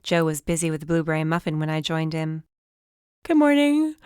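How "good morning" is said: yawned through the words; yawning. A narrator's reading. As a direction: yawn while greeting. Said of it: "I yawned".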